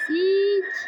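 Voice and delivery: imitating Granny's hiss. Gamer copying what Granny says to viewers, mock creepy. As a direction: say "Siç" like the creepy grandma hissing. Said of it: "Ne diyor bu ya?".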